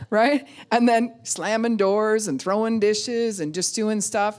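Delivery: normal talking voice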